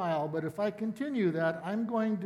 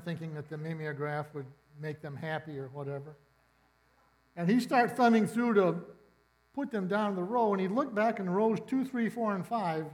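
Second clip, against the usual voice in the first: normal talking voice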